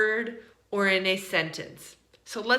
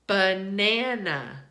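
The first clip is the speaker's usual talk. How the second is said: slow, syllable-by-syllable "Banana"; said slowly; slower than usual. Shown as a demonstration. Teacher repeating it for students, slow "Banana". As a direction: draw out "Banana" so each A is heard. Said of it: "Let me say it slowly".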